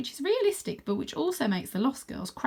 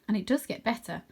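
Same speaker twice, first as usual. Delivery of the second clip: usual speaking voice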